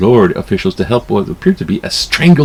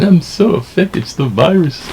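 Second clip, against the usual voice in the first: high, whiny "poor me" voice, mocking; mock self-pity; 9 semitones higher than usual. People faking harm to blame the virus, a mocking high whine. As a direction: ham up a fake victim pitched way up. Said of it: "gonna be saying".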